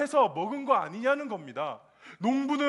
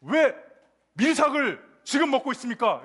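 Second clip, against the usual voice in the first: higher, louder demanding question; accusing challenge; 4 semitones higher, 4 dB louder than usual. Acted. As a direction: confront them like an indignant Pharisee. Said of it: "이렇게 따지는 거예요".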